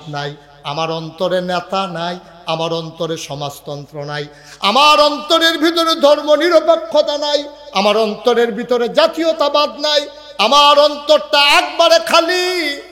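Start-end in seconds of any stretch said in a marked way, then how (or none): 4.6-12.8 higher, louder fervent declaration; rising preaching cry. Real, not acted. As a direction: build to a fervent climax.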